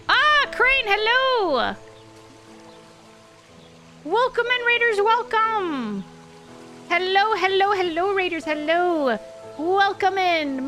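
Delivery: high, bright, drawn-out welcome; excited greeting. Real, not acted. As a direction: greet the raiders with big enthusiasm. Greeting incoming raiders excitedly.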